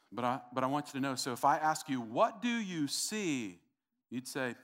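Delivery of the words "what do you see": slow, higher, stretched-out words; drawn-out question. Acted. Preacher asking church about the bottle, drawn-out question. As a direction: stretch each word as a pointed question. Poses demonstration question to congregation.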